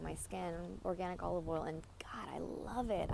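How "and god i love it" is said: breathy whisper of pleasure; whispered delight. Real, not acted. Telling girls about olive oil in a hushed whisper.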